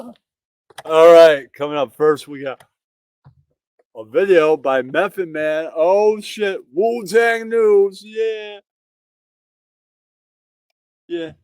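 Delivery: usual speaking voice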